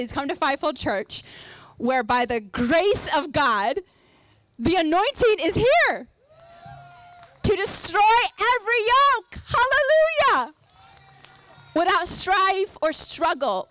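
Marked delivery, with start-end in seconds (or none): 1.8-13.7 rising to a loud preaching shout; shouting faith healer. Real, not acted. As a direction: build up and shout it out. Preacher declaring anointing's power.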